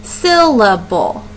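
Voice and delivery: Syllable, slowly split into syl-la-ble; slow demo of the three parts of Syllable; broken into syllables. Shown as a demonstration. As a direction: stretch Syllable out beat by beat. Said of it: "How many different breaks in the word do you hear?".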